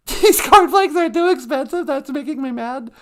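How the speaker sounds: high, loud, rising disbelief; can't believe this is the line; incredulous repeat. Real, not acted.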